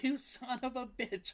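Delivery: high squeaky teasing cry; high mock-insult to a pet; playful pet squeal. Real, not acted. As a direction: squeal it at the cat in mock outrage.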